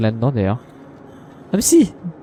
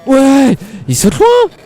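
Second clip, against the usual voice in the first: excited yelp; 13 semitones higher, 7 dB louder, much slower than usual. Real, not acted. Gamer reacts to a big jump.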